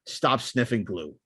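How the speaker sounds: ordinary talk, nothing marked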